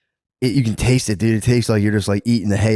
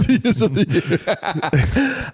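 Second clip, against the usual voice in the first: cracking up through the words, high and slow; can barely get it out for laughing; 10 semitones higher, much slower than usual. Real, not acted. Repeating his friend's joke while laughing hard.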